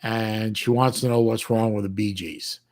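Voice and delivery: ordinary delivery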